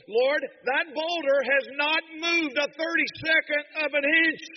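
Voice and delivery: loud, high, desperate venting to God; anguished cry. Acted. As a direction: cry it out at full force, worn out and weeping. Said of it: "He's just venting and he's crying and he's weeping".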